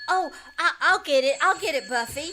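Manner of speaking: loud, eager cry; excited shout. Acted. Girl running to the door for her parents, loud and eager. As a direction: rush to the door hoping it's them. Child rushing to answer door.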